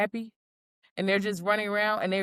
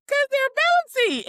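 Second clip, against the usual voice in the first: put-on very high, louder childlike glee; giddy excitement; 6 dB louder, much faster than usual. Explaining to coworkers why she hopped, giddy. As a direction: bounce the words like a kid. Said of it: "I'm like".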